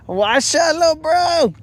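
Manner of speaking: high, squeaky "shut up, bro"; a high, teasing yelp at a buddy; playful outburst. Real, not acted. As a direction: jump way up in pitch in mock disbelief.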